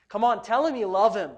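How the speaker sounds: ordinary talk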